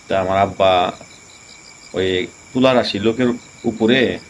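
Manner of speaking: ordinary talk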